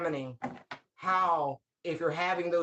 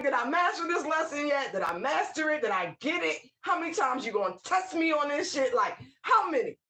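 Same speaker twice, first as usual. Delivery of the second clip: higher, fed-up asking of the universe; exasperated pleading; 7 semitones higher than usual. Retold or imitated. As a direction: plead in mock despair over the same test. Exasperated at being tested again.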